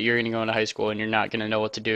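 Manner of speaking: quick, dropped-voice rush through the line; sped up. Retold or imitated. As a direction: rattle it off fast under your breath. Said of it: "Why did I just speed up there?".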